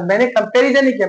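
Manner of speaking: ordinary talk, nothing marked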